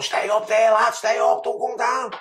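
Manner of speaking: high, loud jeering call from below; mock crowd jeering. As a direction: egg him on like a yelling crowd. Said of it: "with everyone going".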